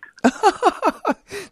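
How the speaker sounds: high, bright burst of real laughter; sudden high laugh from the host. Real, not acted. Host laughs at guest's remark.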